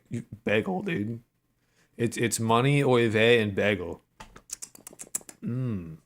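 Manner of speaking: normal talking voice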